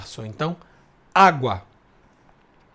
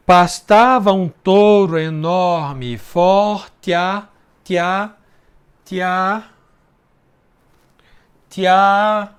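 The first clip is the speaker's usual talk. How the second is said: slow, louder syllable count; slow scansion; 5 dB louder, much slower than usual. Shown as a demonstration. Teacher scanning the verse for students, slow and louder. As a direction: drag each syllable out, counting. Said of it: "outra elisão".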